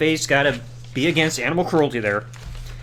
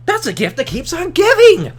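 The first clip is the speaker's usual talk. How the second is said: mock delight; 10 semitones higher, 3 dB louder than usual. Mock delight at Vicky's absence.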